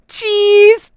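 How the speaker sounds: very high, loud cheese; photo cheer. Acted. Trio posing for a photo, very high squeal. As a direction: squeal for the camera.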